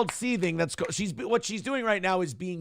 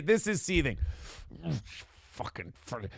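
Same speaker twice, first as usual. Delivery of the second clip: ordinary talk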